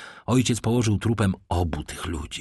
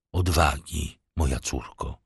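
gentle, slightly insistent; 5 semitones lower than usual. A narrator's reading. Monte Cristo steadies Heidi as she falters, gently firm. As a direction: encourage tenderly, keeping the voice down. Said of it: "mówiąc łagodnie, lecz i z lekkim naciskiem".

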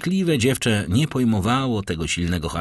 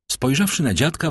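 usual speaking voice

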